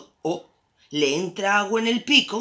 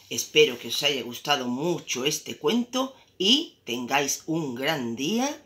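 plain reading voice

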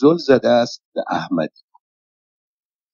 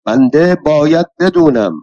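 colonel's sharp raised shout, higher and louder; shouted demand; 6 semitones higher, 4 dB louder than usual. A narrator's reading. Colonel standing over the narrator, shouting his demand. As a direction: yell it down at him, asserting rank. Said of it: "داد زد".

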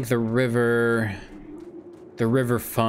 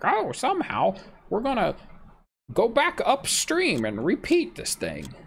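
much higher, put-on mocking quote; 12 semitones higher than usual. Player mocking the game's river reset, high mock voice. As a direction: voice the absurd idea up high.

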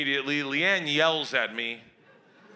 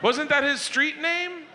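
put-on innocent kid question; 13 semitones higher, 4 dB louder, slower than usual.

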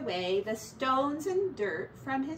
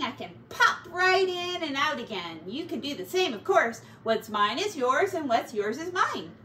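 higher, louder breezy rat neighbour; a lightly raised voice for a jolly rat; cheeky pack rat, pleased with himself; 6 semitones higher, 6 dB louder than usual. Acted. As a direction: sell him as a cheerful freeloader. Ratty's voice held in story.

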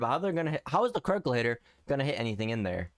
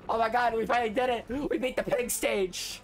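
elated gamer shout; victory cheer; 9 semitones higher than usual. Real, not acted. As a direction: celebrate beating the stage at last. Finally beating the stage.

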